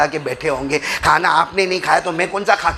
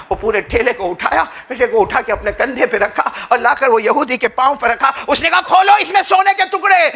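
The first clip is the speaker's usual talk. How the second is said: high, loud impassioned preaching; preaching at full force; 8 semitones higher, 4 dB louder than usual. Acted. Preacher telling the congregation the story, in loud impassioned preaching. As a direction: call it out to the crowd with fervour.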